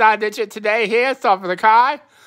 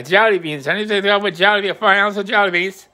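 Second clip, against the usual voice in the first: ordinary talk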